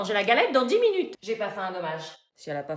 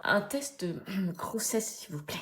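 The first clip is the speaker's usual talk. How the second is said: fading into a murmur; embarrassed mumble; 4 semitones lower, 8 dB quieter, slower than usual. Acted. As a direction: sink lower with shame. Repete, gene, de plus en plus bas. (murmure gêné qui s'éteint)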